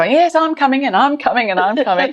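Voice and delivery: high, gushing and eager; squealy playful enthusiasm; mock eager volunteers. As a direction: pile it on like an overkeen guest. Volunteers' eager reply retold.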